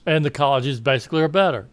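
normal talking voice

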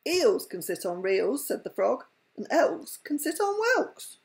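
lively read-aloud with voiced animals; bedtime story reading. A narrator's reading.

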